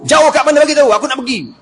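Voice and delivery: high, loud insisting; pushy demand. Acted. Umar insists on going to the house, loud demand. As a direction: keep pushing him.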